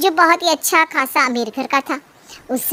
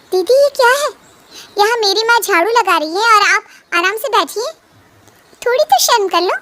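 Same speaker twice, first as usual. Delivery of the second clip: raised, higher pitched outraged voice; angry confrontation; 4 semitones higher than usual. Acted. Madhu scolds her sister-in-law for making her mother sweep, outraged.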